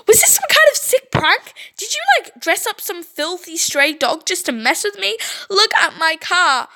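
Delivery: high angry accusing; shrill accusation. Acted. Brittany rages at Stacy about her car, high.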